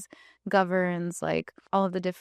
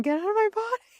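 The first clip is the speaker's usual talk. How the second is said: high mock scream, much higher; imitated scream; much faster than usual. Husband screams at the healer, high hammed-up shriek.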